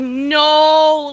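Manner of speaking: dramatic retold dismay; end-of-the-world despair. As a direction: wail it like a disaster. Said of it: "it was literally the end of the world".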